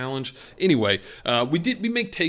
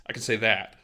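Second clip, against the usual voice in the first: normal talking voice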